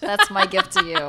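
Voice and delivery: high, loud, through laughter; laughing tease. Real, not acted. Joking to the engaged friend, laughing. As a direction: giggle it out as a joke gift.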